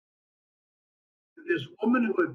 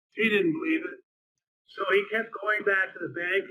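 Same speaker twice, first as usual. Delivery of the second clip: usual speaking voice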